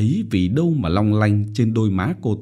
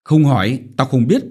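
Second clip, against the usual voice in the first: louder, higher furious snap; angry snap; 4 semitones higher, 5 dB louder than usual. A narrator's reading. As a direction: bite her head off. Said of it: "hầm hầm quay ra gắt". (hầm hầm quát)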